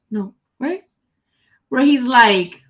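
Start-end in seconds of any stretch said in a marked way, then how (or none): 0.1-0.8 whispered doubt. Real, not acted. Unsure aside to self.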